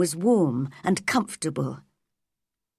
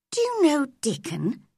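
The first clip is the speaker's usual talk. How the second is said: put-on high girlish question; young girl; 6 semitones higher than usual. A narrator's reading. Mary asks Ben about Dickon, in a high girl's voice.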